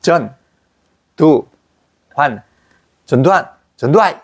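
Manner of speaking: 전두환 said with a Gyeongsang dialect twist; mock Gyeongsang. Teacher imitates Gyeongsang speakers saying 전두환. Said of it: "발음해보라고 하거든".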